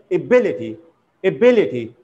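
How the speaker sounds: "ability" said a-BIL-ity; stress on second syllable. Shown as a demonstration. Teacher modelling correct ability, stress on second syllable. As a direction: punch "bil" in each "ability". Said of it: "falls on the second syllable".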